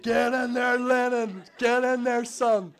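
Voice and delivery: high, loud celebratory shout; triumphant cheer. Retold or imitated. Alone after the officer left, a loud victory cheer. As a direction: cheer yourself on like a football fan. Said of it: "I was buzzing about that".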